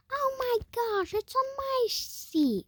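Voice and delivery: very high, drawn-out squeal; mock panic. Mom finds spider on her seat, squealed mock panic. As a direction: imitate a mom squealing in horror.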